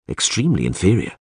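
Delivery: usual speaking voice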